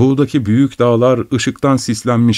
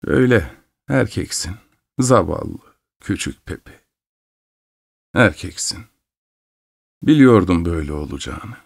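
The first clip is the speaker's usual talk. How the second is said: sad resignation. Acted. Mother resigned, grieving.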